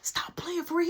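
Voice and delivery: breathy unvoiced whisper of disbelief. Telling Mark to stop joking, urgent whisper. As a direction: hiss it like you can't believe it. Hushed disbelief at friend's news.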